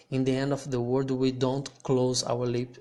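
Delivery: normal talking voice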